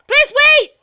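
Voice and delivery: high loud pleading yell; desperate shout. Real, not acted. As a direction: beg them to wait. Begging teammate not to leave.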